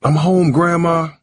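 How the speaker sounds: high, projected call; yelled greeting. A narrator's reading. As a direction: call into the apartment. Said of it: "Harold yelled as he walked".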